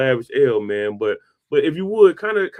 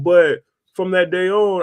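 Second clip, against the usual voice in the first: usual speaking voice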